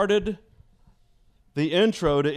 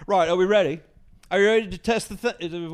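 high, louder British call to the crew; 4 semitones higher, 5 dB louder than usual. Retold or imitated. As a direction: address the sound board like an English star. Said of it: "He goes".

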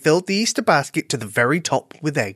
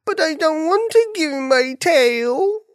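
high, drawn-out plaintive wail; bunny's wail; 14 semitones higher, slower than usual. A narrator's reading. Bunny telling friends he'll lose his tail, wailing. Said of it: "Buffy wailed to his friends".

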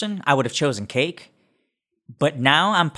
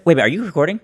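usual speaking voice

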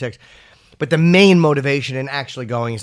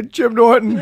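mock crying Matt; 9 semitones higher, 4 dB louder, slower than usual. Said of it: "I hope that's when he cries".

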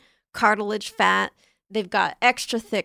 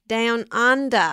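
slow, louder Australian "Down under." with broad drawn-out vowels; Australian accent; 5 dB louder, slower than usual. Shown as a demonstration. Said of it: "should I try an Australian accent?".

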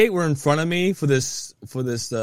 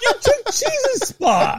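mock outrage; slower than usual. Real, not acted. Mock outrage over lost spot.

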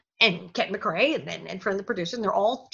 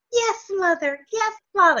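high-pitched mock obedient child; sing-song good little child, hammed up; dutiful child actor; 9 semitones higher than usual. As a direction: parody a well-behaved kid's lines. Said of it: "most child actor parts".

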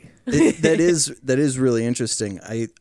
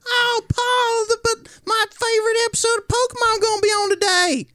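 put-on high, whiny young farmhand; younger whiny voice; 4 dB louder than usual. Younger farmhand whining to Paul, in a high whine. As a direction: whine up high like a kid stuck working. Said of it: "that guy would be like".

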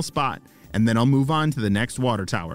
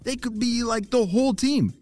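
excited idea; 12 semitones higher than usual. Acted. Ava thinking up her plan aloud.

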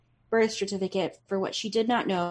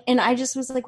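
usual speaking voice

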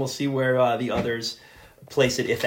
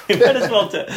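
laughing; 7 semitones higher, 6 dB louder than usual. Real, not acted. Laughing while joking off topic.

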